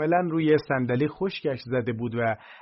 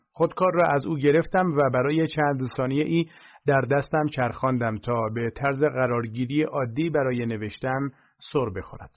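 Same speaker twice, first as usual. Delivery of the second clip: ordinary delivery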